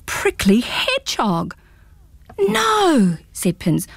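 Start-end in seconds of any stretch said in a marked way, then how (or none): 2.4-3.2 high disbelieving no; can't believe his eyes; astonished boy. Acted. Pins stares at a talking hedgehog, high astonished no.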